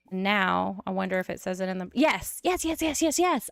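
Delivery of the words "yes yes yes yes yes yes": high, thrilled victory yeses; excited yes. Real, not acted. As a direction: squeal it out like you just won. Celebrating getting the relationship.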